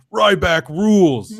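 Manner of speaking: drawn-out wrestler catchphrase; lightly voiced wrestler, stretching each word; slow hint of a wrestling voice; Ryback catchphrase. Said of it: "That's, like, all he says".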